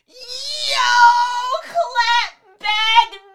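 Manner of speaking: high excited shout, cheering; hype shout. Real, not acted. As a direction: yell it like cheering a comeback. Hyping up David's comeback.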